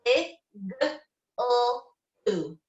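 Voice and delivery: "I, g, l, oo" said as separate sounds; sounded out letter by letter. Shown as a demonstration. Teacher sounding out igloo for young students, one sound at a time. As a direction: break igloo into its sounds, pausing between each. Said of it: "let's do igloo".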